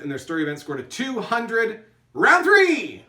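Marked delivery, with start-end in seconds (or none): 2.1-3.0 announcer round call. Pretend. Host closing the round for the teams, booming announcer call. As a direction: round three sung out high and loud. Calling end of round three.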